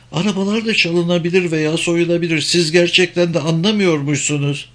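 high-pitched, emphatic woman; high, insistent female character voice; objecting German woman. Acted.

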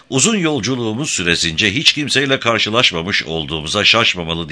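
ordinary delivery

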